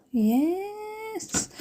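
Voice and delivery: high, soft, drawn-out squeal; mock-delighted cheer. Vlogger approving the dog's salmon oil, high playful squeal. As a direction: stretch it upward in playful approval.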